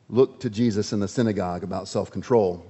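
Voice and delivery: ordinary talk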